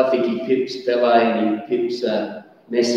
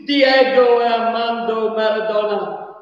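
overwhelmed commentator; 8 semitones higher than usual. Retold or imitated. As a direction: savour each name in awe. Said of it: "he said".

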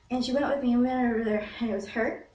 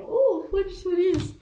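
high, loud ooh of anticipation; real excited outburst; excited curiosity; 11 semitones higher, 8 dB louder, slower than usual. Real, not acted. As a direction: squeal with curiosity at a new message. Phone buzzes, reacts excitedly.

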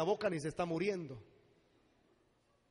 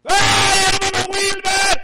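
high, drawn-out wail at full volume; acted scream from hell; 13 semitones higher, 17 dB louder, slower than usual. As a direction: scream for the preacher from the flames. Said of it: "los gritos del infierno".

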